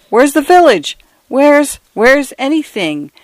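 high, bewildered questions. A narrator's reading. Mister Fotheringay stranded in the storm, bewildered and high. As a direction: ask in dazed disbelief.

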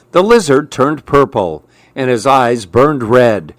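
usual speaking voice

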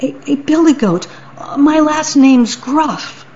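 high, nervous little goat; timid little goat. Acted. Little goat answers the troll, high and timid. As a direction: squeak it out, small and scared.

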